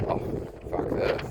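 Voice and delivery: whispered under the breath, slow; a slow, whispered aside to himself while walking out; muttered aside. Retold or imitated. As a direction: breathe it out to yourself as you leave.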